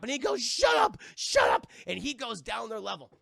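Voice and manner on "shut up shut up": high, loud mock yell, the second one breathy; yelled shut up. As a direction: scream it at them to shut up. Wayne Knight yells at them.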